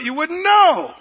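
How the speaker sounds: usual speaking voice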